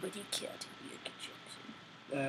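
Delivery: breathy whisper, slow. Acted. Whispering the question back to her friend. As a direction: breathe it out slowly.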